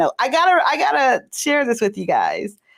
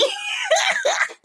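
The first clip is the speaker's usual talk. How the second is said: shrieking burst of laughter; a high cackle of delight; high laughing shriek; 4 dB quieter than usual. Real, not acted. Amused by a viewer's joke.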